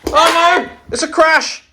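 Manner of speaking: high excited cry. Real, not acted. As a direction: exclaim the crash. Race crash commentary.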